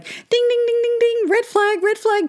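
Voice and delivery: high singsong ding like a warning bell; mock alarm bell. Alarm going off in her head.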